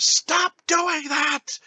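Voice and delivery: very high, drawn-out mock protest; Mister Green. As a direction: imitate a panicked movie line, high and stretched. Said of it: "and then Mister Green goes".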